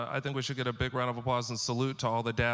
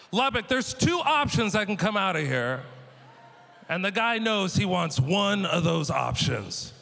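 emphatic to crowd; 6 semitones higher, 4 dB louder than usual. Real, not acted.

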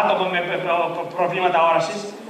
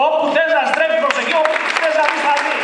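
emphatic shout; 6 semitones higher than usual. Shown as a demonstration. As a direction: shout it out to the crowd. Επανάληψη της φράσης φωναχτά. (δυνατά, με έμφαση, σαν να φωνάζει στο κοινό)

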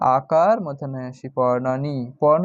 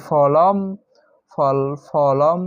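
slow demo; much slower than usual. Shown as a demonstration. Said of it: "teacher shows the word ending".

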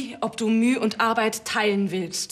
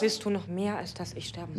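much softer, trailing into a whisper; timid, hardly audible line reading; too quiet; 3 semitones lower, 8 dB quieter than usual. Acted. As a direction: fade to breath on the last words. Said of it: "ruhig ein bisschen lauter sprechen".

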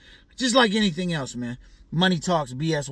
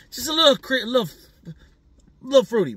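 higher, louder, teasing voice; teasing insinuation; 7 semitones higher, 5 dB louder, faster than usual. Real, not acted. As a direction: lift the voice to hint at something. Hinting the man seems fruity.

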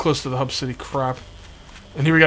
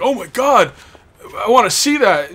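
excited fan; 8 semitones higher, 7 dB louder than usual. Retold or imitated. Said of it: "you guys would have been like".

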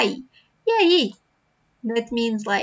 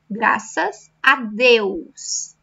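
slow model phrase; much slower than usual. Shown as a demonstration. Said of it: "teacher modelling phrase slowly".

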